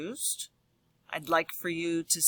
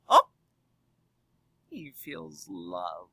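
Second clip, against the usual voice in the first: lively storybook voice for a class; reading to children. Acted. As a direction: read it aloud to the kids with big expression. Reads picture book to class.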